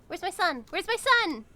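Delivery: high, rising panicked shout; panicked parent searching. Pretend. Streamer searching for lost pet son, frantic.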